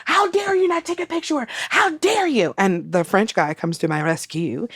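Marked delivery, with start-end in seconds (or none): 0.1-2.5 high-pitched mock reprimand, like scolding a child; mock shouted scolding. Retelling the fan scolding her like a child, high-pitched mock scold. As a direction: wag a finger and scold from way up high.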